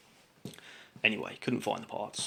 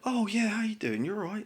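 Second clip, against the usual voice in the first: fake cheery greeting; 11 semitones higher than usual. Mimicking Matt's fake cheer.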